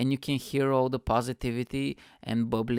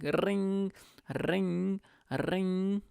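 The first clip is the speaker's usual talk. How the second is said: high trilled "ring"; high-pitched ringing noise with rolled R; phone ring imitation; 7 semitones higher, much slower than usual. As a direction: imitate an old telephone ringing. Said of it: "And I was like".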